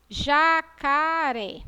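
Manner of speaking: JACARÉ stretched slowly, syllable by syllable; long, open final É in Jacaré; drawn-out syllables. Shown as a demonstration. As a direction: sound out Jacaré slowly for a class. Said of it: "qual é a vogalzinha".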